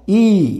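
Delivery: "ई" as a long ee, not eye; phonic sound of I. Shown as a demonstration. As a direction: draw out "ई" as ee. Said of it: "आयचा उच्चार निघतो".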